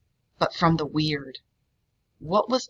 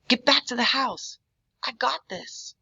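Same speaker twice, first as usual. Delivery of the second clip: a lightly voiced stranger, strained and high; strained command; 7 semitones higher than usual. A narrator's reading. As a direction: push the order out mid-struggle. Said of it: "his voice strained".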